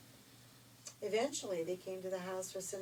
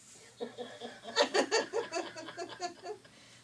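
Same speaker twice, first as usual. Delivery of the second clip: laugh; 10 semitones higher, 7 dB louder than usual. Real, not acted. Storyteller laughs at ugly sisters.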